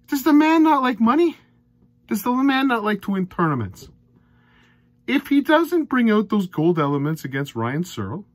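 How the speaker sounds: loud, high, exasperated rant at the player; fed-up darts fan ranting loudly. Real, not acted. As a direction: vent in disbelief, almost yelling. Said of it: "Sorry for my rants".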